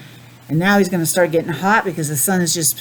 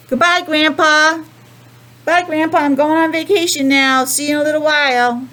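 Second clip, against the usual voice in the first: cheerful goodbye; 7 semitones higher than usual. Acted. Penguin waves goodbye to grandpa.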